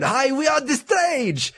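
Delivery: high, slow imitation of an Italian accent; imitating an Italian band greeting the crowd in an Italian accent. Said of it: "in their Italian accents going".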